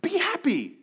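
imitating a friend telling him to be happy; mock cheerful advice. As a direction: parody a clueless friend.

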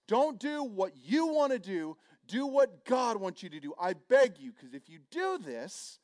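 high, slow, pleading cry to the crowd; pleading scream. Retold or imitated. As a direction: beg the people not to do it, voice strained high. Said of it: "he's screaming at all the people".

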